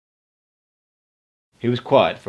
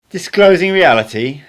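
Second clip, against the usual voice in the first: higher, louder mocking echo of my words; mimicking me; 8 semitones higher, 8 dB louder than usual. Don Juan mocks my phrase, higher and louder. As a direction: parrot my phrase back, teasing. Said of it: "he said, mimicking me".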